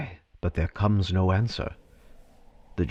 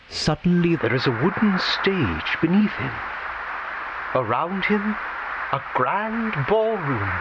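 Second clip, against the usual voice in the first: higher and higher naming each friend; building excitement; 9 semitones higher than usual. A narrator's reading. Narrator revealing the ballroom and friends, building excitement. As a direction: climb with each name.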